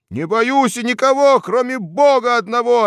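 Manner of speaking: ecstatic cry. A narrator's reading. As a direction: cry it in rapture. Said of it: "ответил Харюзин в экстазе".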